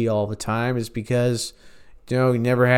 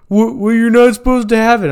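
high, fast, stammering whine; mocking squealer voice; 9 semitones higher, 5 dB louder, faster than usual. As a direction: whine it fast like a scared man. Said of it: "He's like".

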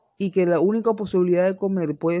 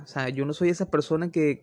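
ordinary talk, nothing marked

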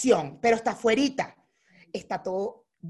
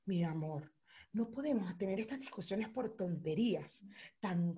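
conciliador, como un santo; 2 semitones lower, 11 dB quieter than usual. Retold or imitated. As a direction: imitate a patient husband calming her quietly. La pareja llega conciliador como un santo. (voz suave y conciliadora, imitando)

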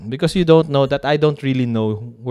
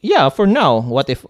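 ordinary delivery

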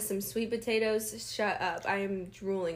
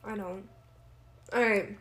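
ordinary talk

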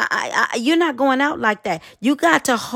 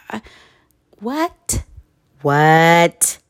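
exasperated; 4 semitones lower, much slower than usual. Acted. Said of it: "sighing and rolling her eyes like".

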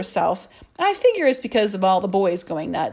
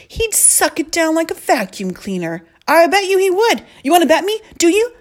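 goading challenge; 9 semitones higher, 6 dB louder than usual. Acted. Narrated kid goading, rising.

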